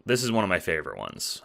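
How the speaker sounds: usual speaking voice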